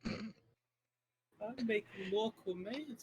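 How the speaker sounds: Uwu speak. Acted. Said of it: "unironic Uwu speak".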